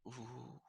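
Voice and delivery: whispered eerie ooh; a hushed shiver of suspense; spooky whispered ooh. A narrator's reading. Spooky build-up at the lair.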